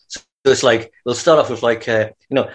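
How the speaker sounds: ordinary delivery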